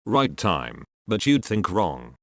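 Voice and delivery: normal talking voice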